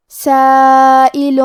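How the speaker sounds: سائل with the alif drawn out long, recited; stretched madd. Shown as a demonstration. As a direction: recite سائل holding the madd four counts. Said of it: "یہاں الف پہ مد کا علامہ ہے". (سائل کو مد کے ساتھ لمبا کر کے)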